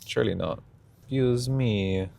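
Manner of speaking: ordinary delivery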